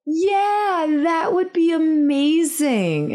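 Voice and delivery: high, drawn-out gushing; fake enthusiasm. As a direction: overdo the delight, stretching it out slowly. Faking enthusiasm to the other mom.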